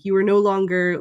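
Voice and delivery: usual speaking voice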